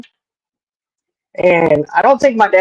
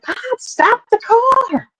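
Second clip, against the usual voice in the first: high, slow, drawn-out plea from the mother; a mother stretching every word, pitched high; urgent mom; much slower than usual. Retold or imitated. Mom orders dad to stop.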